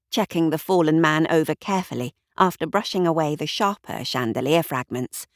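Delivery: usual speaking voice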